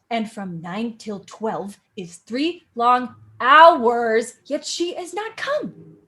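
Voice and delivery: slow, weighty line reading; reading Shakespeare aloud. Acted. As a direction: speak the verse as Juliet, drawn out. Reads Juliet's lines aloud.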